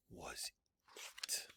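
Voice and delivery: tense whisper. A narrator's reading. Narrator whispering the big moment. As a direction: whisper it with suspense.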